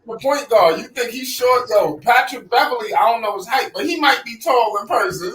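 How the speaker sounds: usual speaking voice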